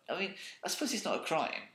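usual speaking voice

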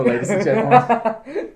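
high, slow, loud showman; announcer voice. Acted. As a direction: ringmaster address to the audience. Mock announcing to audience.

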